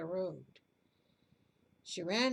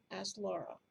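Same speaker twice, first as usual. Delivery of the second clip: ordinary delivery, nothing marked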